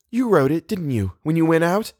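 high, hopeful questioning. A narrator's reading. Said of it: "Eleanor turned hopefully".

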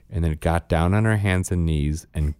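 usual speaking voice

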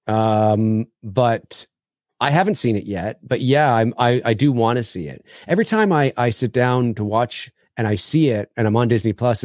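normal talking voice

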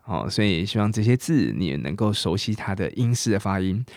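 ordinary talk

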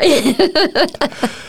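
light high laughter; real amused laugh. Real, not acted. Laughs after healthy people.

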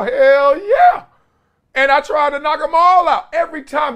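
high, loud, fired-up brag; excited boast. Real, not acted. Ex-player bragging to viewers about knockout hits, fired up. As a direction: shout it proud like a knockout memory.

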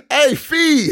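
hammed-up greeting "Hey, feed" with the name mangled; mock wrong pronunciation. Joking about botching the director's name, calling out "feed". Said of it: "That's what I would've been like".

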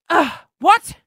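high, loud, affronted comeback; irritated reply. Retold or imitated. Passenger's irritated reply, acted.

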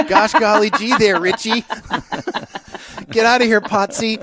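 put-on high, loud gee-whiz fifties sitcom kid; wholesome 1950s kid. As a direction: ham up a Happy Days teen. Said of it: "happy days in nineteen fifty".

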